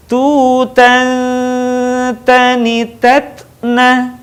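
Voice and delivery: slow chant, long hum ending "Tutan"; held nasal. Shown as a demonstration. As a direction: hold the n of "Tutan", then continue evenly. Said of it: "tahan suaranya". ("Tutan" ditahan dengung, dilantunkan pelan)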